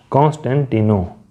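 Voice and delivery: usual speaking voice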